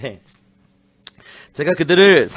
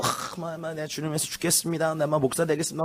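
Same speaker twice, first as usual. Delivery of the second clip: put-on quiet, fervent teen at retreat; half-mocking a kid's big promise; mock teen vow; 8 dB quieter than usual. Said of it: "막, 막 이러네요". (수련회에서 다짐하는 아이 흉내, 조용하고 들뜬 말투)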